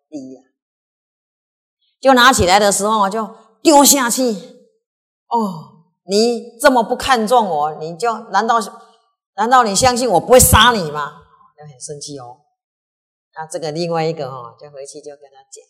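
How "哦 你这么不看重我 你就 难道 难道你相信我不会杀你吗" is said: the king roaring a death threat; furious threat. Acted. King threatening the disrespectful retainer, furious shout.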